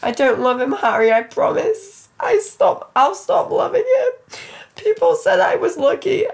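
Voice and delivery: high laughing-crying plea; pleading through mock sobs; sobbing. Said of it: "I sob".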